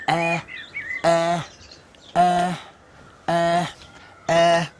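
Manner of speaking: presenter searches for an E word; sounding out E. Shown as a demonstration. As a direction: call out E, E, E, searching. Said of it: "which makes an E sound".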